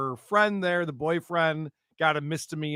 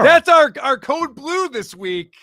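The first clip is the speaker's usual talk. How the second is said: higher, louder, slow hype; excited announcement; 10 semitones higher, 10 dB louder, slower than usual. Real, not acted. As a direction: announce it like a big reveal.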